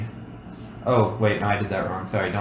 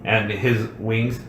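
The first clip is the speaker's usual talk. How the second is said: ordinary delivery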